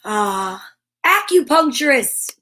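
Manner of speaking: usual speaking voice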